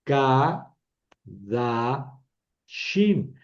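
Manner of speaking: Ka, da, shim with a pause between each; three isolated beats: ka... da... shim; syllable by syllable. Shown as a demonstration. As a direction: model Ka, da, shim as separate syllables. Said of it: "Las dos primeras son sílabas cerradas".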